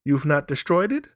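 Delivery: put-on high, loud old woman's voice; a woman pleading high and loud; wife's voice. A narrator's reading. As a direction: raise the pitch and press the question. Wife voiced in story.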